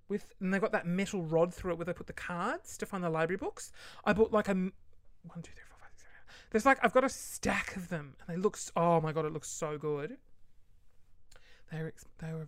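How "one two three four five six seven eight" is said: quick private tally, barely audible; hushed counting to oneself; whispered counting. Real, not acted. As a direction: count under the breath. Counting items to herself.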